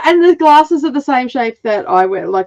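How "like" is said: ordinary talk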